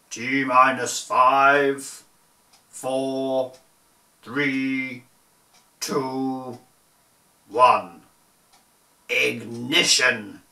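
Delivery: slow, dramatic countdown building to a loud ignition; launch countdown. Acted. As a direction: count it down like mission control.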